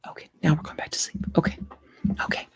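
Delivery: soft whisper by a sleeper; very quiet, voiceless hush. Real, not acted. Hushing for sleeping puppy.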